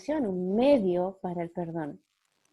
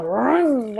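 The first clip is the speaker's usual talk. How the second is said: motorbike rum; 4 semitones higher, 9 dB louder than usual. Retold or imitated. Imitating motorbike again.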